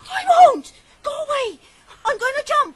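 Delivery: frantic screaming threat; hysterical shout. Acted. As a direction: scream it at them from the brink. Girl threatens to jump.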